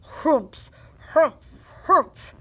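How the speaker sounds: short, loud bird harrumphs; yelling bird. A narrator's reading. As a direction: huff out each one in a burst, like an indignant bird. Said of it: "yelled a bonga bird".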